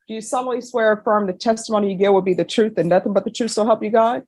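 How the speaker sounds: fast, rattled-off swearing-in; rote court oath. Real, not acted. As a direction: recite it quickly by rote. Judge swears in the witness.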